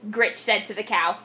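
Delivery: ordinary delivery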